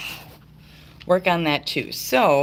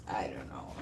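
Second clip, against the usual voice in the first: whispering; 13 semitones lower, 10 dB quieter than usual. Real, not acted. Aside about filters.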